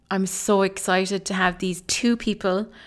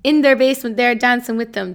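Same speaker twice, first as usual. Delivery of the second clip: excited gush; 5 semitones higher, 9 dB louder than usual. Retold or imitated. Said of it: "I'm literally like".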